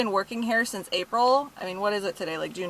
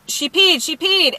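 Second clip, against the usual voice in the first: high, loud mock yell; insistent raised-voice tattling; retold screaming; 7 semitones higher, 5 dB louder than usual. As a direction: re-enact shouting an accusation across the store. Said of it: "I'm, like, screaming too".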